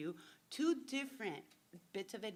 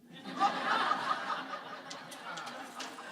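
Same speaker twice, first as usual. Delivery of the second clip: laugh; 11 semitones higher, 4 dB louder than usual. Real, not acted. Laughs at own punchline.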